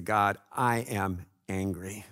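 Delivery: lightly voiced prayer to God, pleading; a touch of heartfelt pleading; a hint of earnest prayer. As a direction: pray honestly, asking for help. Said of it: "I can go to God and just say".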